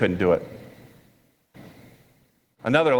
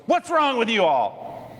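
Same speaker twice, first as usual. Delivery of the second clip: high, slow, upset reproach; drawn-out mock exasperation, pitched up; 8 semitones higher, slower than usual. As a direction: act out an upset teacher scolding everyone. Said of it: "It sounds like he's upset".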